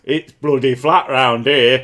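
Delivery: put-on slow, loud, broad Northern England accent. Playing a Northern flat earther, broad Northern England accent. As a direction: drawl it like a Yorkshire farmer in Northern England accent. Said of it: "accent that I think of".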